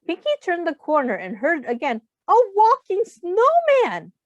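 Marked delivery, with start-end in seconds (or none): 2.3-4.1 high, loud, slow exclamation; amazed shout. Acted. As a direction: cry out in astonishment. Townsfolk exclaiming at Pinky.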